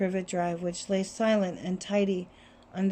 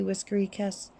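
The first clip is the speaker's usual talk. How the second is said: ordinary delivery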